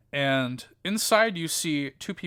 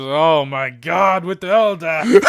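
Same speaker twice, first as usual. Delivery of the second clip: exasperated shout; 3 semitones higher, 10 dB louder than usual. Acted. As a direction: throw up his hands and shout it. Embrick exasperated outburst.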